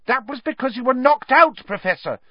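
high-pitched, earnest pupil. A narrator's reading. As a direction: plead your point earnestly, pitched high. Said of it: "said Hermione earnestly".

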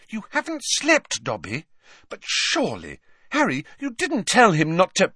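indignant questioning, jumping high. A narrator's reading. Girl indignant on an overworked elf's behalf, protesting in high pitch. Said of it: "Hermione looked indignant".

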